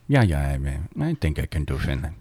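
low, soft attempt at a Finnish accent. Shown as a demonstration. Answering the co-host's challenge, Finnish accent. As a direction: mull it over in a Finnish accent. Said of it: "an accent from someone from Finland".

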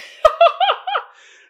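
loud high cackle; sudden high-pitched laugh. Real, not acted. Laughs at own joke.

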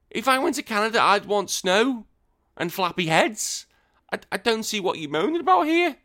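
high-pitched mock indignation; incredulous protest. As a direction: squeal in baffled disbelief at the listener. Mock argument with a Canadian listener.

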